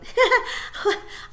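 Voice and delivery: put-on a light, breathless girlish giggle; silly giggle. Girl faking a giggle for the soldiers. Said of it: "tried to giggle the way her sister might".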